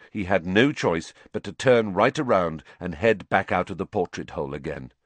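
plain reading voice

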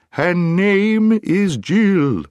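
high, very slow, drawn-out shout; loud owl call. A narrator's reading. Owl spelling things out for a deaf dwarf, shouting slowly. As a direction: hoot each word out long and loud.

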